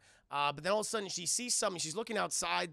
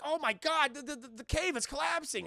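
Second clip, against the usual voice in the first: higher-pitched, stammering mock alarm; Leia panicking; 7 semitones higher, 3 dB louder than usual. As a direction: fret in acted fright. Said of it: "Leia's like".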